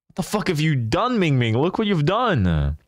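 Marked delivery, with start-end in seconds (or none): none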